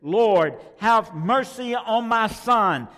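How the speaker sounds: loud, slow begging for his son; a father begs the Lord for his son, loud plea; father's plea. Acted. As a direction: cry out to the Lord for your child. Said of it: "He said".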